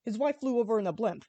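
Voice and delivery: ordinary delivery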